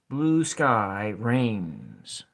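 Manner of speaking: very slow, each word drawn out; slow sounding out. Shown as a demonstration. Teacher testing a haiku line for students, slow. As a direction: stretch every syllable to count it. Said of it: "sounding out a haiku line".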